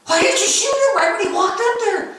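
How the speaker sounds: high-pitched baffled question; incredulous demand. Retold or imitated. Dad questioning his son about waiting, incredulous.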